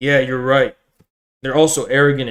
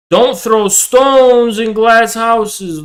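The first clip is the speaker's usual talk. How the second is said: mocking lecture; 7 semitones higher, 3 dB louder, much slower than usual. Real, not acted. Lecturing the hater slowly.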